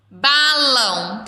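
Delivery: BALÃO slow, with a long held ão; ending sounded out. Shown as a demonstration. Teacher asking children to compare endings, ending sounded out. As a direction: stretch "Balão" so the final ão rings. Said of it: "terminar com o mesmo som".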